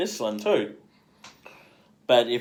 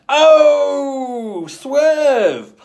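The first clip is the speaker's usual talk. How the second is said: much higher, loud sing-song taunt; mock swerve; 9 dB louder than usual. Charlize's character dodging the blackmailer, high mocking taunt. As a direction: toss it off high and loud like a dodge.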